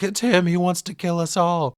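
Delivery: high, loud frantic cry; losing her mind with fear; panicked. Retold or imitated. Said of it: "Anne's losing her mind".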